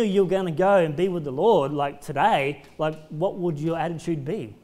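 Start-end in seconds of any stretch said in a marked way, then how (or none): none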